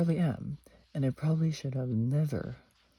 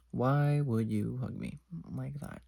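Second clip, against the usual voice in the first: ordinary talk, nothing marked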